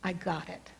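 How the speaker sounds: soft, measured inner assurance; hushed, steady reassurance; quiet divine voice. Retold or imitated. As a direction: say it gently, as God heard in the heart. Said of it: "in the quietness of your heart".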